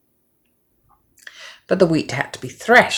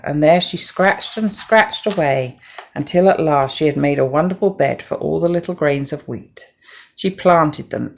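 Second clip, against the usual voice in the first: ordinary delivery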